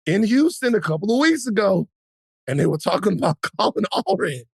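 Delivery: ordinary talk, nothing marked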